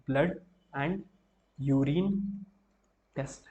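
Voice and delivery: word by word with long pauses, for notes; slow, spaced dictation for students taking notes. Shown as a demonstration. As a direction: spell it out slowly so they can write it down. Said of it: "मैं कोशिश कर रहा हूँ धीमे ही बोलूँ".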